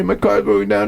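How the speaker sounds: high, whiny "My car's broken down."; whiny complaint. Retold or imitated. Stranded driver whining to his buddy, "My car's broken down." high and sulky. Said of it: "starts laughing and goes".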